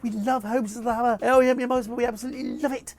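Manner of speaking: high, loud fan enthusiasm; gushing praise. Retold or imitated. Vlogger imitating Julie Walters praising his show, loud gushing. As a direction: gush as an adoring fan.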